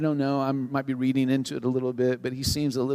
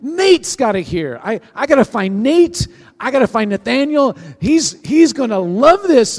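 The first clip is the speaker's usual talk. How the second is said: much higher, loud, near shouting with joy; like he's about to explode with good news; bursting excitement; 9 semitones higher, 10 dB louder than usual. Retold or imitated. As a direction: Philip overjoyed, shouting that everyone must hear. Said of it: "And he's like".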